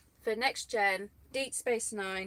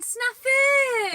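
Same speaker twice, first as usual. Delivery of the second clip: excited shout; 11 dB louder than usual. Real, not acted. Excited greeting to viewer in chat.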